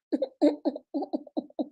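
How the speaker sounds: small, soft, high mock sobs; imitated pretty Oyibo cry. Shown as a demonstration. Showing listeners how Oyibo people cry, soft mock sobbing. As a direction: fake a restrained little cry. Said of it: "You know how Oyibo dey cry".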